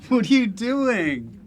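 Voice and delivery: loud, high greeting; hearty mock surprise. Host greeting his guest on arrival, loud mock surprise.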